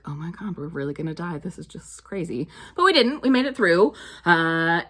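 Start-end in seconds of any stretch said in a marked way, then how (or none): 0.0-2.5 hushed dread. Retold or imitated. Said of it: "I was like".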